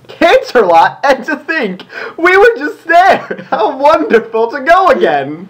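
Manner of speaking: high, excited gushing pony voice; squealing with glee, voice climbing; gleeful Rarity. Acted. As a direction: gush with delight over the trip. Reading Rarity's gleeful line.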